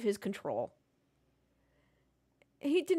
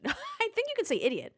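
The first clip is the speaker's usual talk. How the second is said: laughing; 13 semitones higher than usual. Real, not acted. Laughing at the FTC joke.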